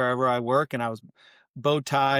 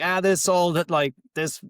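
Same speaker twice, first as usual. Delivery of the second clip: put-on Boston accent; 4 semitones higher than usual. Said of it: "say things in a heavy Boston accent".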